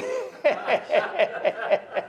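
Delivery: high, rapid run of chuckles; helpless laughter after the punchline; real laugh. Real, not acted. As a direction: crack up at your own story. Laughs at his own joke.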